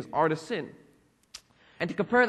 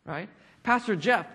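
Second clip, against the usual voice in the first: usual speaking voice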